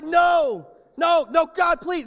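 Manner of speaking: high, loud pleading shout; desperate yell. Acted. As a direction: yell out in alarm to stop a friend. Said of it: "I would yell that".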